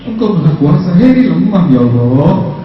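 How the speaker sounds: imitating a tearful, pleading prayer; mock whining plea. Preacher retells Heri begging Allah when broke, mildly whining. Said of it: "nangis kepada Allah. Merengek".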